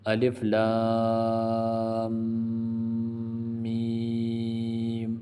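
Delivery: الف لام میم very slow, vowels stretched, nasal hum in the middle; madd and ghunnah shown. Shown as a demonstration. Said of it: "درمیان میں غنہ ہوگا".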